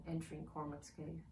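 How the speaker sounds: ordinary delivery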